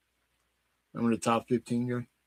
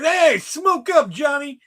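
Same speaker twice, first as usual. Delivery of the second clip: put-on gruff old man shout; 8 dB louder, much slower than usual. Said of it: "old man grabbed me by the collar".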